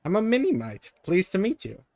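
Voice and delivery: high, bright little sprite voice; chirpy little creature. Acted. Narrator voicing a tiny mite greeting the traveler, squeaky. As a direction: pipe it up like a tiny fairy creature. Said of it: "The creature does a backflip and gives a little bow".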